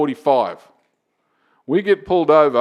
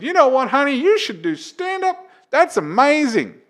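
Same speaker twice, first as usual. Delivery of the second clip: much higher, slowing gushing wife voice; encouraging wife; 11 semitones higher than usual. Acted. Wife telling her husband he's funny, gushing and high. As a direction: play an over-supportive wife, pitched way up. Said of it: "and your wife said".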